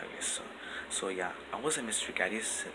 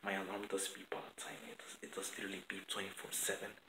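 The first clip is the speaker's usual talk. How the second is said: whispering; 9 dB quieter than usual. Real, not acted. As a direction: keep it down, whispered. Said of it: "I'm whispering because my parents are, like, still asleep".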